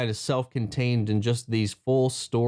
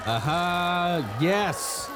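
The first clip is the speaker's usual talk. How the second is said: excited cheer; 3 semitones higher, much slower than usual. Real, not acted. Recognizing a returning planet.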